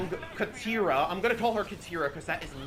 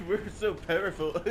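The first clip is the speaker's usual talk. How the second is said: mock soldiers. Mocking Fire Nation soldiers.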